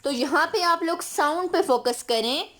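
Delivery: ordinary talk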